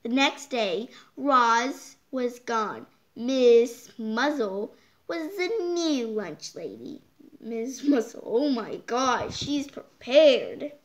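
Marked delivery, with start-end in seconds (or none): none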